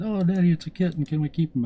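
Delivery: put-on high, loud little girl plea; daughters begging. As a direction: daughters begging Daddy to keep the kitten, high. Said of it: "the girls found him and went out and said".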